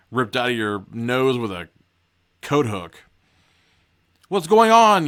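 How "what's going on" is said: high, loud demand for answers; raised, urgent voice; demanding shout. A narrator's reading. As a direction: yell it, alarmed and insistent. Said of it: "you demand".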